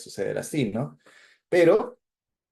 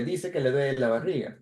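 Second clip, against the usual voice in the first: usual speaking voice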